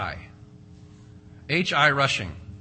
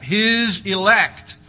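slow emphatic answer; 5 semitones higher, 8 dB louder, much slower than usual. Acted.

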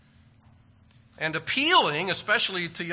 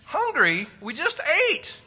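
much higher, a mom in mock disbelief; 12 semitones higher, 4 dB louder than usual. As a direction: sound incredulous at the request. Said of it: "every kid has heard mom say".

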